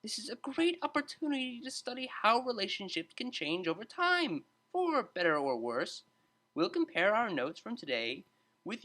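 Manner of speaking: high, even-toned teacher voice; patient explaining. A narrator's reading. Tutor explaining the project to students, patient and high. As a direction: lay it out patiently for the class. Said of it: "Psych explained patiently".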